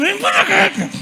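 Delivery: high, laughing mock call of a mother; shrill mock call. Mothers calling their kids over, high and mocking. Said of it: "había gente que decía".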